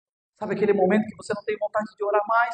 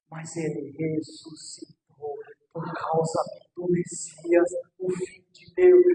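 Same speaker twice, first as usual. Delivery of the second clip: whispered prophecy; 9 semitones lower, 8 dB quieter than usual. Acted. Pastor whispering to the grieving congregation that it is over. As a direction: breathe the words like a secret. Soft quoting Jesus.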